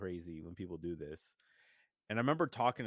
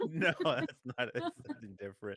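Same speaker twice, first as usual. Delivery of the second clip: laughing denial; 2 semitones higher, 4 dB louder, faster than usual. Real, not acted. A guest laughing while denying the comment meant someone. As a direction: laugh it off and deny it fast.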